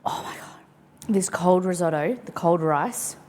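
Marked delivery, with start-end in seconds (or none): 0.0-0.6 breathless whisper. Real, not acted. Quiet after spicy bite.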